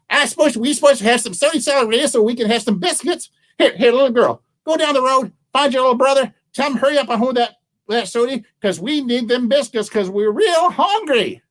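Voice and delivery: high, fussing granny voice; fretting old woman. Acted. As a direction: order them about in a high granny voice.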